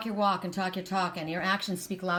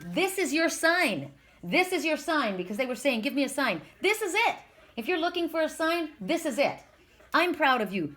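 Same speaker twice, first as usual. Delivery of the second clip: emphatic, raised-pitch pep talk; on a soapbox; 4 semitones higher than usual. Real, not acted. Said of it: "get me on my soapbox".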